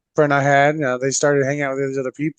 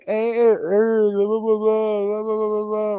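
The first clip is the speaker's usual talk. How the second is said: slightly higher mock complainer babble; mocking moaning; 5 semitones higher than usual. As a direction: imitate a friend droning on with complaints. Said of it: "bitch and moan about".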